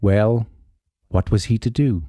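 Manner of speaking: ordinary delivery, nothing marked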